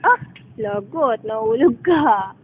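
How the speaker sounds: ordinary talk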